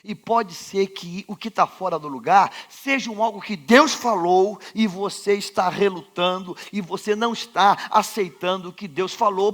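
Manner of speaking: ordinary delivery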